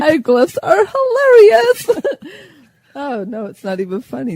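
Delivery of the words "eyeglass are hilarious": high, giggly squeal; giggling. Real, not acted. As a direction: fight back the giggles. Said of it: "get the giggles".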